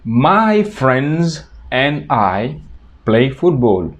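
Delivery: "My friends and I" stretched out slowly; slow model sentence. Shown as a demonstration. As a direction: dictate it slowly to the class. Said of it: "Otro ejemplo sería".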